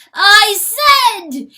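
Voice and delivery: shriek. A narrator's reading. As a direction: shriek in anguish. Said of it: "he shrieks".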